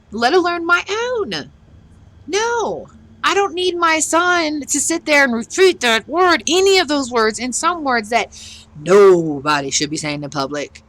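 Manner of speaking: high-pitched, indignant outburst; exasperated rant. Real, not acted. Parent ranting about what a child might repeat, exasperated.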